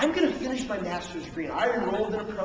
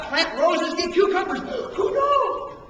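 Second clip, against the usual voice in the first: rising higher and louder to a squeaky finish; playful mock bafflement; 7 semitones higher, 6 dB louder, slower than usual. Preacher joking about sowing and reaping, high mock bafflement. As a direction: climb to a high, comic shrug. Mocking idea of random harvest.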